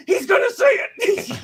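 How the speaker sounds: slow, emphatic, high-pitched declaration; excited fan. Retold or imitated. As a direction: shout it out in certainty. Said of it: "And I'm like".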